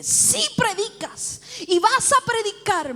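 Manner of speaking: slow, high, insistent pronouncement; emphatic command. Retold or imitated. God insisting on her calling, emphatic command. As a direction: drive it home word by word.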